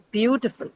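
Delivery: beautiful with BEAU lifted; beautiful, first syllable stressed. Shown as a demonstration. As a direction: hit BEAU at the start of beautiful. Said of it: "on the first syllable, which is beau".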